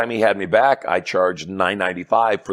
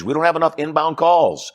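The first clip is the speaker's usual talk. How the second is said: whining; 8 semitones higher than usual. Retold or imitated. Salesperson complaining about too few calls, mock whining. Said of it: "that whines".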